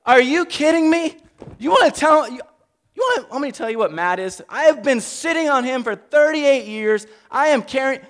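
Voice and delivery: high, loud, exasperated rant; indignant outburst. Acted. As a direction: blow up in disbelief at them. Healed man rants at leaders.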